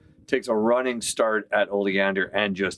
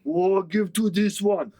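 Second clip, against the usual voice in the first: orc voice; 11 semitones higher than usual. Acted. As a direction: argue like a simple brute. GM voices orc arguing with Drax.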